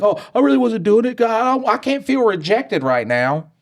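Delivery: very high, silly whiny imitation; goofy mock denial. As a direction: squeal out a fake excuse to dodge rejection. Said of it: "in a goofy way".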